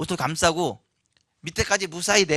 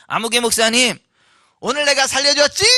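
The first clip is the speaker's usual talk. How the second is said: louder, higher playful tease; teasing with a smile; 5 semitones higher, 11 dB louder than usual. Retold or imitated. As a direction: tease him, smiling, claiming credit. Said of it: "웃으시면서 ... 이렇게 말씀하셨어요". (웃으며 장난스럽게 생색내는 목소리)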